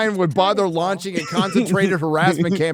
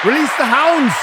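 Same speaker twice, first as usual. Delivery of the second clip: louder, higher hammed-up decree; mock villain command; 8 semitones higher, 6 dB louder than usual. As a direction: unleash the pack like a cartoon tyrant. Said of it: "rallying the chat to troll".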